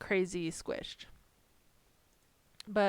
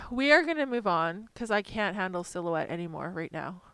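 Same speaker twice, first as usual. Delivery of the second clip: ordinary talk, nothing marked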